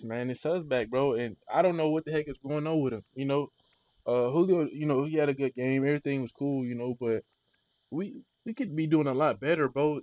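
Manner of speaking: ordinary talk, nothing marked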